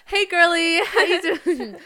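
put-on high-pitched, playful "hey girly" voice; mock girly greeting. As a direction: gush it in a high mock-friendly lilt.

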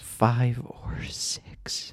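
very slow, stunned whisper; whispered disbelief; horrified whisper. Acted. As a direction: echo it in drawn-out whispered dread. Dismayed echo of the answer.

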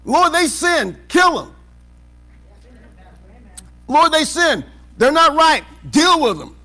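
loud, high, demanding plea to God to punish others; mock self-righteous prayer. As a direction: shout it like a smug believer demanding judgment. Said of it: "Don't you get that way?".

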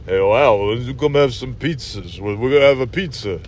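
low, mumbled action-star invitation; casual marble-mouthed tough-guy mumble; Sylvester Stallone. Acted. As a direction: slur it like an aging boxer-movie hero. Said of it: "I think he just said".

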